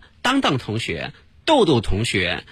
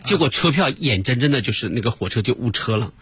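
ordinary talk, nothing marked

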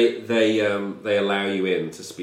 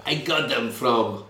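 put-on Russian-accented prisoner; 4 semitones higher than usual. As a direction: taunt the officer in a Russian accent. Prisoner mocks grenade question.

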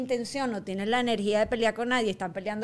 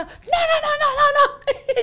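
high, loud rapid-fire no's; mock shouting man who won't listen; angry man's refusal; 9 dB louder, much slower than usual. Man in line refuses her explanation, shouting. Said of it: "y el tipo empezó".

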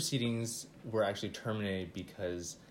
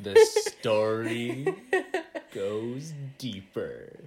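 slow dramatic; 8 dB louder, much slower than usual. Acted.

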